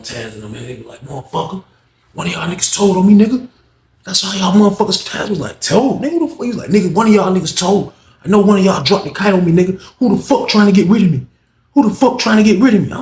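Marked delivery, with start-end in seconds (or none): 1.0-5.6 loud, high furious yelling; raised-voice rage, higher and louder; angry accusation. Retold or imitated. Unc confronting Taz angrily. 5.6-6.4 indignant disbelief. Retold or imitated. Taz denies accusation. 6.4-12.9 loud, high furious yelling; raised-voice rage, higher and louder; angry accusation. Retold or imitated. Unc confronting Taz angrily.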